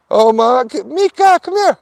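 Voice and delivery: loud, very high call to a distant dog. Real, not acted. The dog owner calls his distant dog, loud and high. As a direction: shout for the dog to come, up high.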